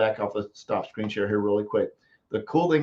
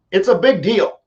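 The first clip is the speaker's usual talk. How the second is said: excited; 7 semitones higher, 9 dB louder than usual. Real, not acted. Excited about trading platform.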